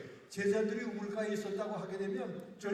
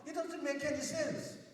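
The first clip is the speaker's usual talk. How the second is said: slow, much higher, dismissive; scornful woman; 7 semitones higher, much slower than usual. Retold or imitated. Samaritan woman scoffing at Jesus' request, slow and high. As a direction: stretch it out in disbelief. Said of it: "이 여자가 예수님을 보고 하는 말이".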